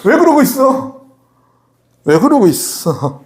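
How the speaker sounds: mock-incredulous asking, high then drawn out; casual modern rebuke. God asking prostrate Joshua why he stays down, casual rebuke. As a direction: playfully chide him as a friend would. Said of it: "요즘 표현으로 이렇게".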